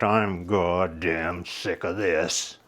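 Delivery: low, slow rumbling growl; thundery rumbly voice. Acted. Old man of the sea rising behind Charlie, rumbling. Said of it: "thundery, rumbly voice remarked".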